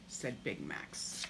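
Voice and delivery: ordinary delivery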